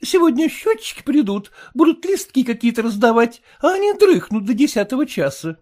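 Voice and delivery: high, sour, fretful wife voice; high complaining wife; sour merchant's wife. A narrator's reading. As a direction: whine it like a sour housewife. Mother scolding daughters.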